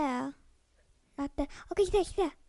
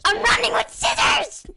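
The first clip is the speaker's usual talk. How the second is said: playful shout; 5 semitones higher, 4 dB louder than usual. Pretend.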